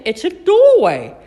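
high, loud, drawn-out reveal; amused exclamation. A narrator's reading. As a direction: announce a funny discovery slowly and loudly. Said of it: "laughed Chip".

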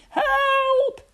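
very high, loud cry for help; panicked high shout. A narrator's reading. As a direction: yell for rescue in a boy's voice. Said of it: "he shouted".

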